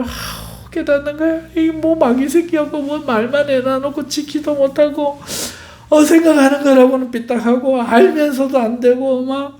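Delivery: high-pitched, acted lament; sigh, then berate yourself in a high, despairing voice; wretched self-reproach. Believer realizing their own failings, wretched lament. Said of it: "우리의 부족함과 비참함을".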